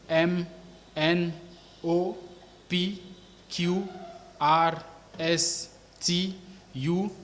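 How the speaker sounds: letter by letter with pauses, pitch mostly lifted; measured classroom alphabet recital; alphabet drill. Shown as a demonstration. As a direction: recite each letter separately for learners.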